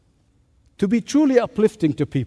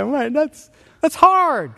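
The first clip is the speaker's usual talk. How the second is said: mock exasperation; 8 semitones higher, slower than usual. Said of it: "you say".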